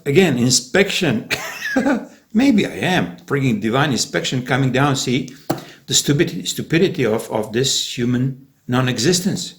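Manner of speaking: normal talking voice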